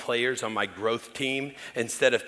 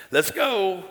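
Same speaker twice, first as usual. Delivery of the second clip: eager, higher, louder let's go; imitating dad; 8 semitones higher, 4 dB louder than usual. Pastor imitating his eager father at the door, high and loud. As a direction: blurt it like an impatient old man. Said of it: "he goes".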